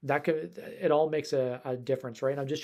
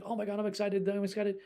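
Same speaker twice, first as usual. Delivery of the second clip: higher, giddy dog voice; excited dog; 6 semitones higher than usual. Pretend. Dog greeting guests at the door, giddy and higher. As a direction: gush like a jumping puppy.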